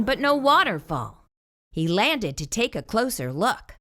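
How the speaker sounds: ordinary delivery, nothing marked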